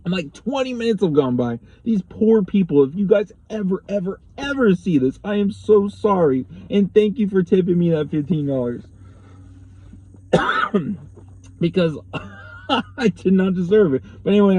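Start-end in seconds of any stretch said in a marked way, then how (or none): 1.8-8.8 higher, louder, heartfelt plea; earnest, insistent sorry and thanks; emphatic apology. Real, not acted. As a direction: stress each word of the apology, pitched up. Apologising to customers who might watch.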